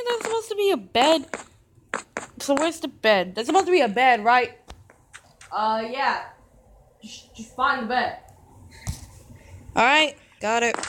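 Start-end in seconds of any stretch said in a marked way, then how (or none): 5.5-8.2 a lightly different, quieter voice; voice actor voice. Acted. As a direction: slip into a second character's voice. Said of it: "That was my voice actor".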